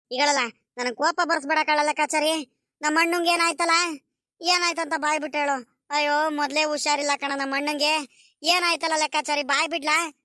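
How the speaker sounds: put-on high old voice asking what happened; a village elder fussing anxiously over the boy; worried old villager. Old villager demands an answer. (ಆತಂಕದಿಂದ ಕೇಳುವ ಹಳ್ಳಿಯ ಹಿರಿಯನ ಎತ್ತರದ ಧ್ವನಿ)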